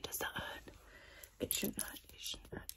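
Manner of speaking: hushed reassuring whisper; ASMR whisper. Acted. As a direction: whisper softly near the ear.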